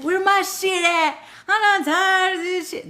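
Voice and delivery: mock a spoiled, impatient person, high and loud; impatient demand. Reader mimicking an impatient client demanding results, whiny. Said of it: "'Cause if you're always like".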